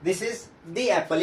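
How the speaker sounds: "the" said dee, slowly; slow, clear dee for "the". Shown as a demonstration. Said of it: "उच्चारण क्या हो जाएगा, दी".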